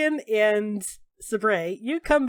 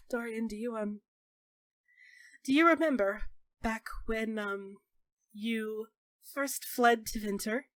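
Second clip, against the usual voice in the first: hesitant and sad; 7 dB quieter than usual. Acted. May hesitant sad confession.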